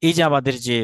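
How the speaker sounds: ordinary talk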